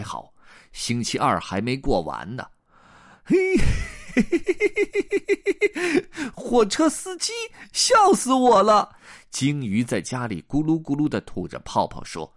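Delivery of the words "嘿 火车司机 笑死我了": high-pitched giggling fit, then jeer; shrieky staccato laughter into high words; goldfish laughing mockingly. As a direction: cackle up high, then tease. Goldfish mocks the train driver.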